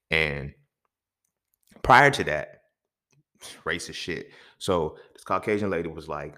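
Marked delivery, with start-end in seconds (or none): none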